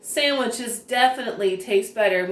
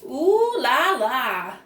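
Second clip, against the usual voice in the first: slow, drawn-out ooh la la, a bit higher; fancy ooh la la; 3 semitones higher, much slower than usual. Acted. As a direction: savour it like a fashion queen. Nancy admiring fancy accessories.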